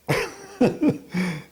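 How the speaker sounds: a quick high-pitched chuckle; amused little laugh at his own scenario; short high laugh. Real, not acted. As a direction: laugh briefly at the thought. Laughs at imagined awkward encounter.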